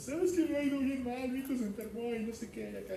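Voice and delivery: high, put-on pleading tenant voice; mock tenant whining to the landlord on the phone; whiny tenant.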